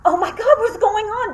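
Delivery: high, loud startled cry of panic; panicked alarm. Retold or imitated. Jumping away from the wall as students scream, panicked. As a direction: blurt it out in sudden fright. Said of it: "And I was like".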